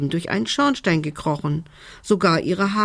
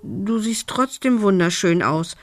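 stammering; 4 semitones higher than usual. A narrator's reading. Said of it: "stotterte Lumbi verlegen".

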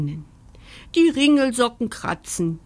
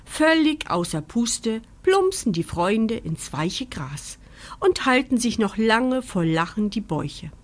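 plain reading voice

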